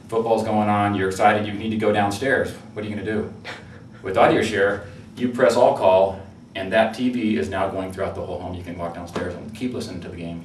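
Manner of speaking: ordinary talk, nothing marked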